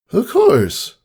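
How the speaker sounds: put-on high young filly voice, a touch weary; worn-out filly agreeing; tired Scootaloo. A narrator's reading. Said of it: "her tone matched the fatigue".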